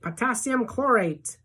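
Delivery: high, drawn-out call; thrilled, stretched-out cry; excited shout. A narrator's reading. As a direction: call it out high, like naming each firework.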